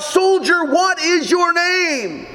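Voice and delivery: high booming demand. A narrator's reading. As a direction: boom the question out commanding. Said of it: "in a booming voice cried out".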